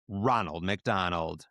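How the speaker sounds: ordinary delivery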